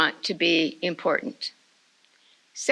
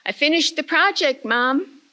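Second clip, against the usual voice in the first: happy announcement; 6 semitones higher than usual. Retold or imitated. Boy tells his mom he finished, bright and eager. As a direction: bounce in proudly with good news. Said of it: "came out of the bedroom smiling".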